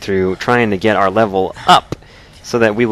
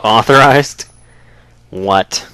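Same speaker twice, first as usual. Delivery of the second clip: indignant echo; 5 dB louder, much slower than usual. Real, not acted.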